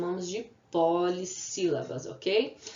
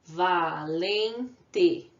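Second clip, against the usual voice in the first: ordinary talk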